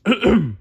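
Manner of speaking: loud comic throat clear in a high voice. A narrator's reading. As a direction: clear the throat before a grand reading. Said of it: "He cleared his throat".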